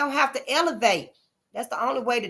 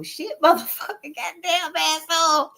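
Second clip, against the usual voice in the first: higher-pitched curses with a laugh, slowing at the end; gleeful kid cussing; 6 semitones higher than usual. Retold or imitated. Retelling first time cussing as a kid, gleeful. Childhood cussing repeated with more relish.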